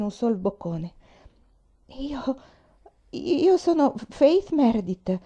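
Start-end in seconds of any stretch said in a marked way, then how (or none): 2.0-5.2 timid, higher-pitched halting reply; thread of voice. A narrator's reading. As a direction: falter it out slowly, like a scared girl. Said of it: "disse con un fil di voce".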